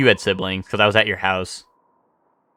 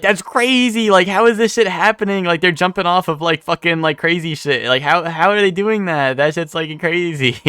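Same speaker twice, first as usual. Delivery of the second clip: higher-pitched, wide-eyed retelling of amazement; amazed niece; 6 semitones higher than usual. Retold or imitated. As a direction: imitate a kid marvelling at wrestling. Voices amazed niece watching wrestling.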